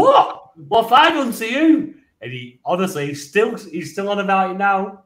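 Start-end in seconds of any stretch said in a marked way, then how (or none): 0.0-1.9 very high, loud squawk of disbelief; hammed-up wounded outrage; retold indignant protest. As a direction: protest your innocence at the top of your voice. Acts his protest to angry dad.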